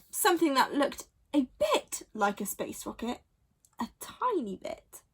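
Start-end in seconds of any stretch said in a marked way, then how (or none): 3.8-5.0 whispered, cheeky aside. A narrator's reading. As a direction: narrator whispers to the listener, teasing. Narrator's aside.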